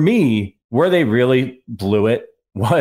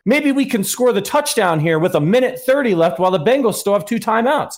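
much higher, mock-eager suggestion; mocking the coaches; 9 semitones higher than usual. Coaches choosing to pass with the lead, mocked as clueless. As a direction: parody a foolish bright idea in raised pitch. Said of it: "they're like".

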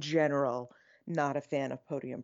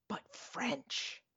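hushed awe; 7 semitones higher, 8 dB quieter than usual. Real, not acted. Awed punchline about Paris ceremony.

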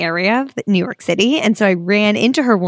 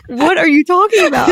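confused; 8 semitones higher, 4 dB louder than usual. Retold or imitated. Confused reply to mom.

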